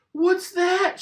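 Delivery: put-on very high little girl piping; high childlike question; Peppa's voice. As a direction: squeak it like a small piglet.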